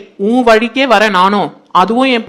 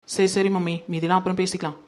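hushed aside; 3 semitones lower, 10 dB quieter than usual. Acted. Daughter hushes the plotting talk.

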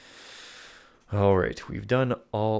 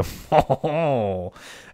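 loud, higher villain laugh; triumphant laugh; 5 semitones higher, 5 dB louder than usual. Acted. Lafond gloats at the dance, triumphant laugh. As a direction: let out a hammed-up laugh of triumph. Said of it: "He laughs triumphantly".

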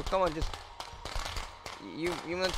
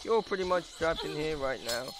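ordinary delivery

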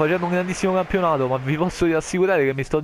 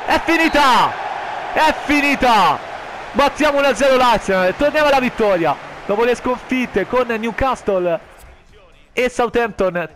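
high, loud victory shout; excited final whistle call; 7 semitones higher, 4 dB louder than usual. Acted.

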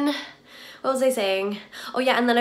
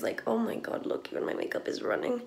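whispering; 11 dB quieter than usual. Real, not acted. Showing her running makeup to camera.

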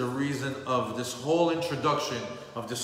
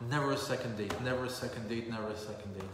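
each repeat softer, leaning on never; fading refrain; 2 semitones lower, 5 dB quieter, faster than usual. Acted. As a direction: let the phrase die away.

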